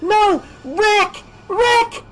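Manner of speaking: desperate shouts, very high; shouting. Acted. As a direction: fight them off and scream for him. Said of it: "she shouted".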